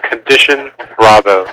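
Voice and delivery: ordinary delivery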